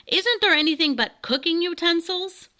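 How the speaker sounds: high, loud indignant bird king; impatient king. Acted. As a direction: cut in, scornful and shrill. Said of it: "interrupted the king".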